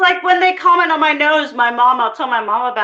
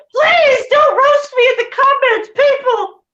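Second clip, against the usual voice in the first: loud plea; 12 semitones higher, 4 dB louder than usual. Real, not acted. Pleading loudly with commenters.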